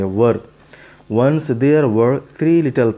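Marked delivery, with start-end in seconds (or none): 0.0-0.4 "वर" repeated alone as a model, "wur"; correct pronunciation. Shown as a demonstration. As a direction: model "वर" for were, one syllable. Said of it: "इसको वर पढ़ेंगे".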